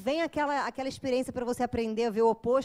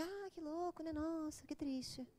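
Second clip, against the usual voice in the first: much softer, absent-minded "que triste"; feigned vague sympathy; 12 dB quieter, slower than usual. Acted. Psychologist faking interest to a client who won't listen, much softer. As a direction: murmur it with a blank face. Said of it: "você faz aquela cara de paisagem".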